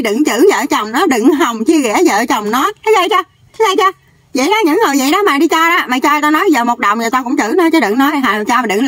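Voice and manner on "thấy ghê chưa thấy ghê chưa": higher, very fast mocking squeal; a quick, high sneering jab; mock outrage. Mocking exclamation mid-rant.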